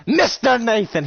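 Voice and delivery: high, loud put-on summons; silly calling voice. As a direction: call the name out in a playful silly voice. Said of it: "Why are you talking in that voice?".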